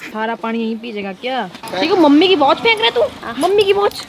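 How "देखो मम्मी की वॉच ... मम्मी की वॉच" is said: higher and louder, calling out the culprit; loud tattling shout. Acted. Sibling calls out to everyone that mummy's watch was thrown, high loud tattle. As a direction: shout it out as if getting him in trouble.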